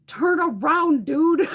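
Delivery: urging the bird toward the open door, a high loud plea; exasperated call. Retold or imitated. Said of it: "And I'm just like".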